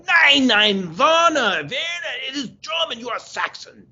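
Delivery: high mock German insistence; German accent. German team explaining his Saxon name, high German accent. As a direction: wag a finger, nein nein, in German accent.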